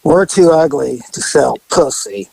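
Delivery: lightly imitated gravelly woman; a hint of gravel, imitating a rough-living woman. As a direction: retell it with a slightly gravelly rasp. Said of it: "they got that gravelly voice".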